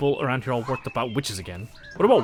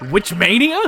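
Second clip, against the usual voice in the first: "Witch mania?" asked higher and louder, rising on "mania"; incredulous echo; 6 semitones higher, 9 dB louder, slower than usual. Real, not acted. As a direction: throw "Witch mania?" back in disbelief. Incredulous repeat of read line.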